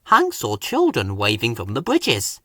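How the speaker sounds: plain reading voice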